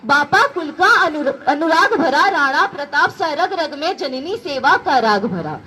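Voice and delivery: rousing heroic verse with rising and falling lines; poem recitation. Acted. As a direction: recite it like a stirring ode to Rana Pratap. (वीर रस का जोशीला कविता पाठ)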